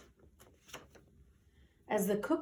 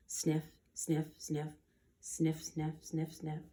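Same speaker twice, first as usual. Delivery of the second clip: sniffing dog; 3 semitones lower, 9 dB quieter than usual. A narrator's reading. Dog sniffing out barbecue smell.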